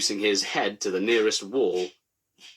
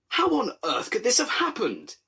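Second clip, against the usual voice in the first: pitched way up, a fussy inspector crying out; high, distressed inspector cry; 13 semitones higher than usual. A narrator's reading. Inspector at the crash, crying out high and fussy. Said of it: "the inspector cried".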